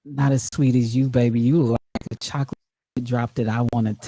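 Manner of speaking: low smooth pickup guy; man voice. Pretend. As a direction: lay on the charm, low. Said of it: "man voice so good".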